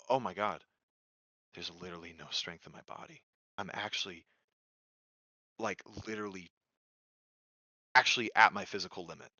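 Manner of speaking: low, very soft and halting; spent, exhausted. Retold or imitated. Swimmer failing to climb out of the water, quietly exhausted. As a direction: let the voice drain away, out of strength. Retold moment of total exhaustion.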